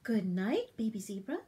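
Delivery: plain reading voice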